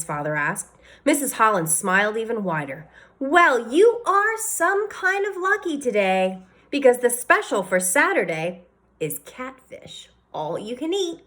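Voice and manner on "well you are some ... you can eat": a waitress proudly announcing the catfish; cheerful waitress. A narrator's reading.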